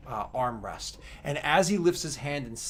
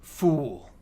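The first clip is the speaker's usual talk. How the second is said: scornful; 2 semitones higher, 6 dB louder than usual. Acted. Accuser scorns the emperor.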